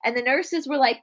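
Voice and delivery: ordinary talk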